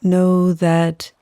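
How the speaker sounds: unhurried yoga nidra guidance, letting each phrase settle; guided meditation. Acted.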